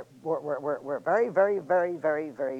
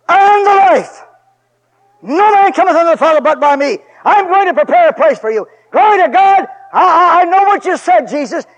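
full-force preaching; 10 semitones higher, 15 dB louder than usual. Acted. Preacher crying out to his church, loud and high. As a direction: shout the promise out to the congregation.

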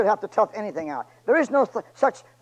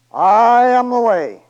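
loud, very slow proclamation; drawn-out preached quote; 5 semitones higher, 12 dB louder, much slower than usual. Acted. Preacher proclaiming Jesus's words to the church, loud and slow. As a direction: proclaim each word like a bell.

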